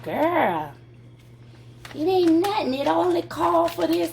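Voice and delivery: high, loud church-lady sass; Mama Hattie's voice. Retold or imitated. Imitating Mama Hattie telling her it's nothing, loud and high. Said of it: "That's how she talk".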